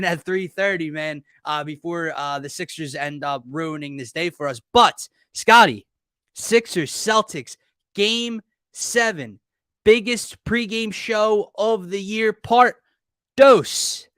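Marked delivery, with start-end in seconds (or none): 4.7-14.1 high, slow, drawn-out big-game announcement; announcer hype intro. Acted. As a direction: build up the showdown, punching each phrase slowly. Hypes up the game seven show.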